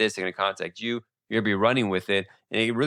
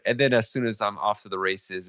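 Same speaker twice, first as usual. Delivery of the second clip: normal talking voice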